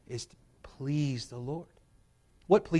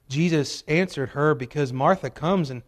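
ordinary talk, nothing marked

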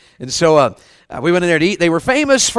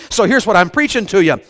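ordinary delivery

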